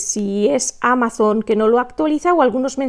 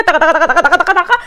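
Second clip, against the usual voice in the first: quick, loud "taca-taca" rattle; mimicking someone on a roll; imitated rapid-fire chatter; 5 semitones higher, 5 dB louder, much faster than usual. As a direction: rattle it off like a machine gun. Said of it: "cuando se pone Jorge".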